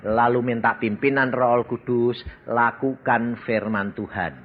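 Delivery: normal talking voice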